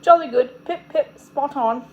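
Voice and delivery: hammed-up British chap; fake British accent. A narrator's reading. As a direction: rattle off British phrases in a put-on accent. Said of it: "on a fake British accent".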